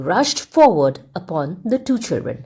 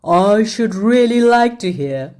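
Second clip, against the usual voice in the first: wicked king. A narrator's reading. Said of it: "the wicked king went on".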